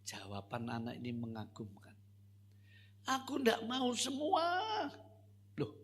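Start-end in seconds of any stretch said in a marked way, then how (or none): 3.1-4.4 put-on stubborn child. Child answers maid.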